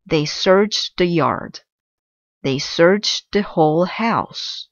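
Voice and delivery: ordinary delivery, nothing marked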